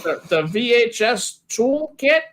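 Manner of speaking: high, playful put-on voice; high-pitched silly character. Asking Harry about copying to V H S, high silly voice. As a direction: pitch it way up, mock-clueless about the tech.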